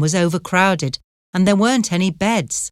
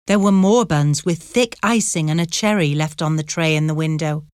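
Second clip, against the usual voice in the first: usual speaking voice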